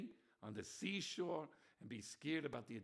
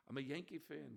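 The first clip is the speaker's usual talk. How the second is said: ordinary talk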